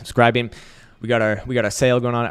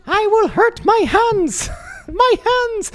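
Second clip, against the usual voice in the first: put-on very high, slow squeaky girlfriend voice; imitating Elsa; 5 dB louder, much slower than usual. Mocking his Finnish girlfriend's complaint in a high squeaky voice. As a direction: mimic her whiny complaint in a tiny high voice. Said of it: "can you say it?".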